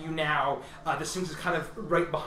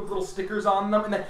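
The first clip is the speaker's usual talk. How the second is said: usual speaking voice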